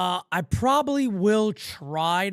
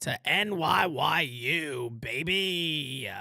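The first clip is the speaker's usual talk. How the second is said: slow, stretched-out subscribe; stretched-out hype for the channel; drawn-out plug; 6 semitones lower, much slower than usual. Acted. Stream plug.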